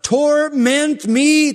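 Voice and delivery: high, loud pleading that slows at the end; shrill desperate demon plea, drawn out on the last words; begging demon voice. Acted. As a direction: beg like a terrified demon. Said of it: "That's the demon talking".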